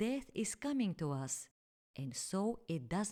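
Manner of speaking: ordinary delivery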